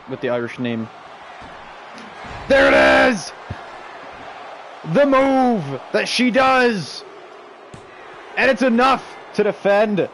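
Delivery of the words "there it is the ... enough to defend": wrestling commentator climax; shouted call. Real, not acted. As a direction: announce the win big. Calling the winning move.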